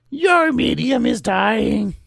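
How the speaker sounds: high, slow sing-song taunt; sing-song Marge voice. Retold or imitated. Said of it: "adding in a little sing-song voice".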